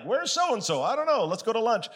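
high-pitched, breezy shrug of a question; offhand brush-off. Retold or imitated. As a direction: toss it off high and carefree. Acted offhand churchgoer quote.

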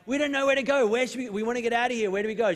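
high, loud, panicky backpacker plea; frantic plea. Retold or imitated. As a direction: beg for a way out, flustered. Pleading with hostel lady.

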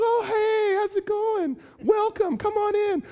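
sky-high, sugary fake welcome; fake cheerful. Greeting the guest Stacy with fake sweetness. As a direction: gush with forced cheer to hide anger. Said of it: "You are so livid".

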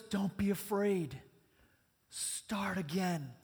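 slow and gentle; soft, unhurried comfort; gentle reassurance. Acted. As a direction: say it like a promise. Repeating Mark's comfort tenderly.

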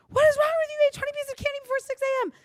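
high, loud, fast scolding shriek of a fed-up mom; angry mom yell. Retold or imitated. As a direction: blow up at the kid as an exasperated mother. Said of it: "instead of saying".

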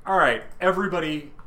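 high, loud and fast call to order; commanding call. Retold or imitated. Hela halting everyone in her realm, loud command. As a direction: call the room to attention. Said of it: "And she's like".

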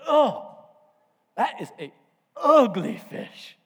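slow, drawn-out disdain on "ugly"; a dad theatrically appalled by what he sees; acted disgust. Dad mocks child's fish in story.

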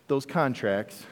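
ordinary delivery

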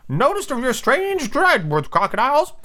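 high, slow sidekick voice; high-pitched henchman; Smee. Acted. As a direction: observe it high and drawn-out. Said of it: "said Smee".

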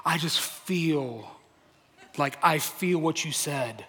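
slow, soft, dreamy imitation of a moved listener; lightly voiced churchgoer savouring the words, slow and soft; a hint of a deeply moved listener, slow and soft; emotional listener. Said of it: "Like some".

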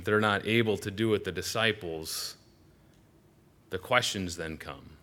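normal talking voice

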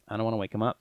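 ordinary talk

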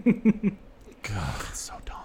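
hushed disgusted aside; whispering. Real, not acted. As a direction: breathe it out in disbelief. Whispered aside about plot.

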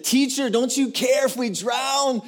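high-pitched panicked shout; acted disciples in terror; freaking out. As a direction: cry out frantically for rescue. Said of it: "They're freaking out, full of fear".

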